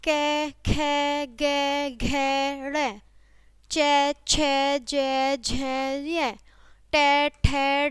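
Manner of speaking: slow, drawn-out letters on a level tone; letter-by-letter recitation. Shown as a demonstration. Teacher reciting the consonant chart to children, drawn-out recitation. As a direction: recite each consonant long and evenly, in groups.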